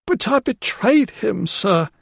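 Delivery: high, slow plea from a remorseful maid; pleading housekeeper. A narrator's reading. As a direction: beg forgiveness, voice lifted high.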